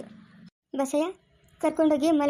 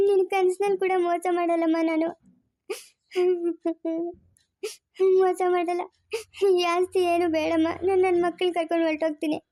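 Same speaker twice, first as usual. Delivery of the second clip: pleading through tears, voice raised and breaking; crying; 3 semitones higher than usual. Acted. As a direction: sob out the plea, begging. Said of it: "ಯಾಕ್ ಅಳ್ತಾ ಇದ್ಯಾ?".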